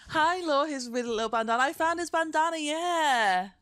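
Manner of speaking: high, loud sing-song voice for the dog; pet talk. Real, not acted. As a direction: coo hello to the pup. Greeting the dog.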